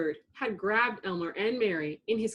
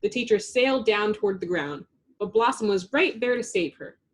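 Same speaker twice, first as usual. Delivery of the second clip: ordinary delivery, nothing marked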